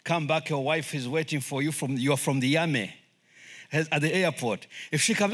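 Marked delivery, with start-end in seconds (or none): none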